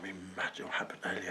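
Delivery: soft, fast, near-whispered gossip; hushed confiding. Retold or imitated. Daughter telling mum earlier events, hushed confiding. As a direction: let mum in quickly, barely voiced.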